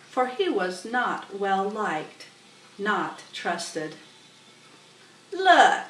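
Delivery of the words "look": high, loud sharp rat voice. Acted. Templeton addressing the goose, sharp. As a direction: snap it out like a rat. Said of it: "he began in his sharp voice".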